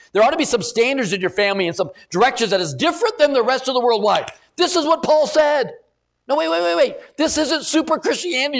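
high, loud preacher's climb; full-force preaching. Acted. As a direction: build the sermon to a peak.

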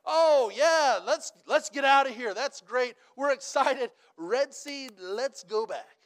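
high, loud giddy mock cheer that slows at the end; hammed-up crowd excitement, slowing on let's go back. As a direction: play the fickle crowd's glee, then draw out the turn back. Preacher mocks Israelites again.

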